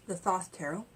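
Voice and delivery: usual speaking voice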